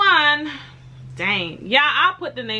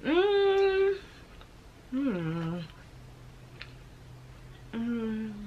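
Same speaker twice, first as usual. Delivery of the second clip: chewing while humming; muffled closed-lip murmur; mouth full; 10 dB quieter, much slower than usual. Real, not acted. Seen 2: Bit pastry at -9; closed-mouth chewing, hums through about +4.6s.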